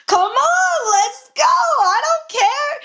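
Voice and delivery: high, drawn-out retold challenge; defiant dare. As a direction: egg him on to fight, pitch way up. Acted dare in retold quarrel.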